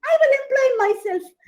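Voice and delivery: high, loud cry of resolve; defiant squirrel cry. Acted. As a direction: squirrel vows to work for itself, defiant and loud.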